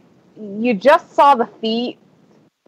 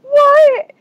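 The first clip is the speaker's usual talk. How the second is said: very high, louder imitated reaction; shocked disbelief; 5 dB louder than usual. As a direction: imitate a friend's stunned squeal. Acting friend's shocked reply.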